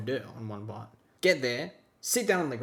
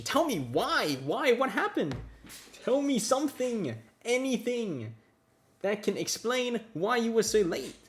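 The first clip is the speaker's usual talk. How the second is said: demanding; 8 semitones higher than usual. Retold or imitated. Demanding explanation.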